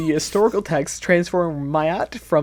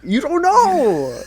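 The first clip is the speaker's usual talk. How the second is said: high, slow teasing taunt; drawn-out taunt; 13 semitones higher, 8 dB louder, slower than usual. Pretend. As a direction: stretch it out to annoy him.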